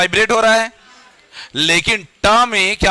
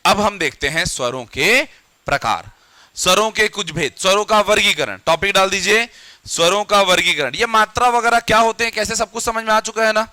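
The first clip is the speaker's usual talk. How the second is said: normal talking voice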